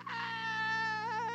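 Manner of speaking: very high mock baby wail; mimicking a screaming baby; baby's scream. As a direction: shriek like a colicky baby.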